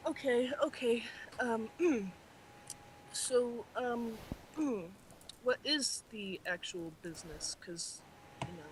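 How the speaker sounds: very soft, halting near-whisper; subdued, collecting himself. Acted. Clark rubbing his temples before asking about business, subdued. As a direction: pick the words quietly, with pauses. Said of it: "rubs his temples and he's like".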